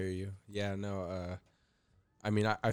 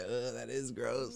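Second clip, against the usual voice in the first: disgusted; 4 semitones higher, 4 dB quieter, slower than usual. Retold or imitated. Acting disgusted at germs.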